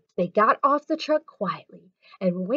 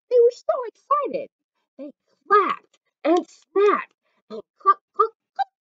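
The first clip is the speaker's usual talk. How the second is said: much higher and louder, eager; excited; 11 semitones higher, 6 dB louder than usual. A narrator's reading. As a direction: bubble over with glee.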